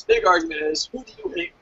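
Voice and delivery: ordinary talk, nothing marked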